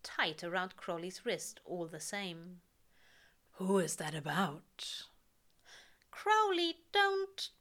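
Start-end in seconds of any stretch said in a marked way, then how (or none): 3.5-5.1 whispering. A narrator's reading. Intimate close question. 6.1-7.5 high, loud flustered plea; pleading. A narrator's reading. Pleading not to read.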